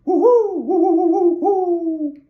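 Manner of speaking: loud, high hooting owl cry; owl call. Acted. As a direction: call out through the woods like an owl. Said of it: "then he called back".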